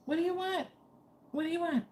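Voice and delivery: very high voice asking a dog; pet talk. Real, not acted. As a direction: coo a high-pitched question to the dog. Talking to whining dog.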